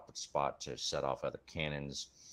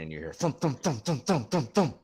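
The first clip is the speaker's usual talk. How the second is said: thump, thump, rising higher and louder; thumping footsteps; 8 semitones higher, 8 dB louder than usual. A narrator's reading. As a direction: stomp it out like heavy footsteps. GM makes footstep sounds.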